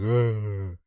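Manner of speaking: loud gruff growl from the dark; old man growl. Retold or imitated. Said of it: "like an old man saying".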